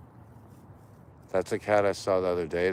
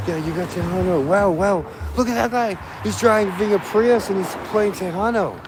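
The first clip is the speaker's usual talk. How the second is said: loud, much higher excited calling; excited shout; 13 semitones higher, 9 dB louder than usual. Real, not acted. Cheering a Prius driver playing Tejano, loud and high. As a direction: whoop at the passing car. Excited at passing car music.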